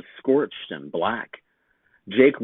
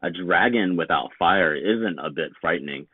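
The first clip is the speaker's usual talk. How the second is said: plain reading voice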